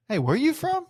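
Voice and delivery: high, bright call from behind him; a high-pitched hey, calling across the room. Retold or imitated. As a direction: hail a familiar face across a crowded bar.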